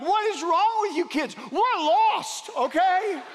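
put-on high, loud child outrage at the laughing kids; indignant little kid. Lost boy scolding the playing kids, high and loud. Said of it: "and I'm like".

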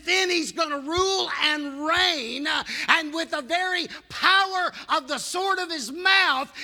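loud, ringing preacher's climax; preaching at full force. Acted. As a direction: build to a shouted proclamation.

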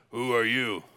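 lightly voiced low tough guy; imitated tough biker. Tough bikers challenge the visiting pastor.

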